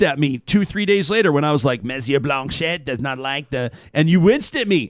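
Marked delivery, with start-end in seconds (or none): 1.8-3.7 slow French-accented impression; mock French accent. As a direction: mimic a Frenchman, taking it slowly. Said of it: "I'm mimicking the French".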